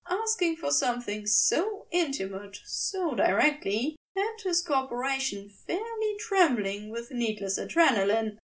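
ordinary delivery, nothing marked

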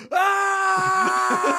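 high, loud mock shriek; panicked scream. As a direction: shriek like a terrified sibling. Siblings panicking in the car.

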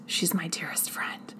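hushed, breathy whisper of affection; soft whispered aside. Real, not acted. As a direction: whisper it like a fond secret. Aside about Josephine's letter.

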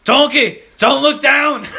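loud high Shrek impression through laughter; Shrek quote. Quoting Shrek to Donkey, loud and laughing. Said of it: "that Shrek scene".

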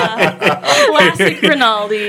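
words squeezed out through laughter; can barely talk for laughing; laughing hard. Real, not acted. Laughing at clip.

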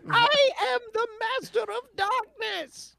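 very high laughing villain squeak; mad scientist. Acted. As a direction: cackle it like a tiny tyrant. Voicing mad Yordle villain.

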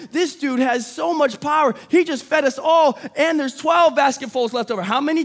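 rally the room like a giveaway host; excited preaching. Acted. Excited preaching climax, Oprah bit.

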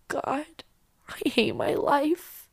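quiet, slow, hopeless sigh; despairing. Retold or imitated. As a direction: say it drained of hope. Despairing aside at his bedside.

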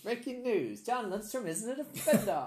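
high, loud newsboy-style announcement; barking newspaper editor. Retold or imitated. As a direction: bark the headline like a 1920s editor. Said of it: "newspaper editor from the nineteen twenties".

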